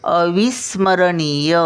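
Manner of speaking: अविस्मरणीय with नी drawn out, वी quick; slow teaching demo. Shown as a demonstration. Grandmother drilling children on अविस्मरणीय, long नी. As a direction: stretch नी in अविस्मरणीय, keep वी short. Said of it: "नी ओढला जातो".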